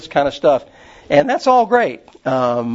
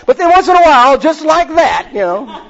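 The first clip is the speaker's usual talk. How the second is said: Gene Wilder's rabbi; 12 semitones higher, 6 dB louder than usual. Retold or imitated. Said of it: "And then Gene Wilder says".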